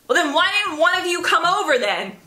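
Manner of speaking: high-pitched mock indignant boss; imitated passive-aggressive. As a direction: imitate a boss huffily blaming the staff. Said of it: "really passive-aggressive things like that".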